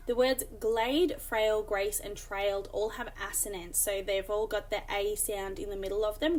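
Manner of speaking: glade, frail, grace stretched to show the A sound; drawn-out vowels. Shown as a demonstration. Teacher stretching the A vowel for students. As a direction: lean on the long A in each word. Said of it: "You can hear the A sound".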